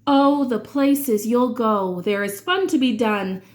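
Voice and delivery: usual speaking voice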